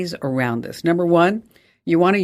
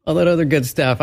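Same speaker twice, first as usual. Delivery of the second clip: higher, fast, playful mock panic; mock panicked caller; 6 semitones higher than usual. Host imitating a caller desperate for the internet, hammed-up panic. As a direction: rattle it off like someone desperate to get online.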